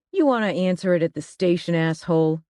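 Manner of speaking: plain reading voice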